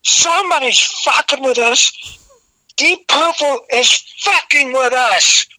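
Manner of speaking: high-pitched reenacted rant; yelling. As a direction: yell it like an outraged singer to a huge crowd. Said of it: "and I'm yelling".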